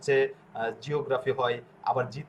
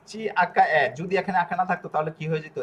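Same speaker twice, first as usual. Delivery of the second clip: usual speaking voice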